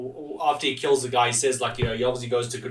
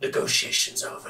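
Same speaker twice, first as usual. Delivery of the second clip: growly Judge Dredd voice; 5 dB quieter than usual. Retold or imitated. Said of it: "this very growly voice".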